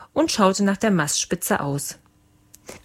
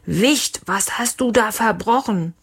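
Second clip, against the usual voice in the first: mild bellow, pitched up; angry roar; 3 semitones higher than usual. A narrator's reading. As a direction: demand it in indignant outrage. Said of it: "Leons Papa brüllte".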